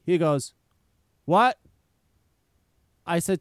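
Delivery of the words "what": higher, louder mock-puzzled "what"; feigned ignorance. Mechanic picks up and pretends it isn't him, feigning ignorance. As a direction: play dumb, imitating the evasive mechanic. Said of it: "pretending like it wasn't him".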